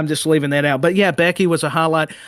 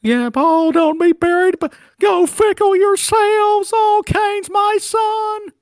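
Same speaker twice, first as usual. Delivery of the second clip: high, slow, eerie wailing manager voice; Paul Bearer impression; 5 dB louder, slower than usual. Co-host doing a slow, high Paul Bearer voice for laughs. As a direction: stretch it out high and ghostly like the old manager.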